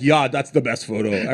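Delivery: put-on lightly done German accent; a hint of a German accent. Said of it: "I'm doing a German accent".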